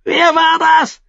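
high, loud furious screech; shrieking. A narrator's reading. King hit by dung demands the culprit, shrieking. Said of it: "kreischte Joffrey".

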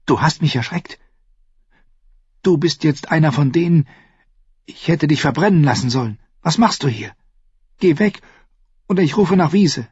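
put-on high, tense girl voice; scared girl snapping at him; angry frightened girl.

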